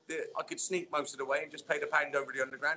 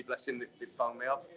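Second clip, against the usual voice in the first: ordinary talk, nothing marked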